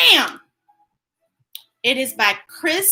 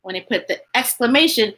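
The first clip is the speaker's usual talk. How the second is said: usual speaking voice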